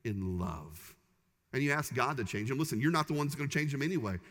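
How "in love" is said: gently spoken. Shown as a demonstration. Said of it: "don't have to do it in a way that intimidates".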